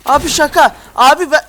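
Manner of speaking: loud high broken-Turkish pleading; loud mock plea in pidgin Turkish; mock foreigner voice. Gamer voices broken-Turkish character.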